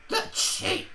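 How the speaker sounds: put-on high, breathless old woman huff; indignant old crone. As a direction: scoff like an offended granny.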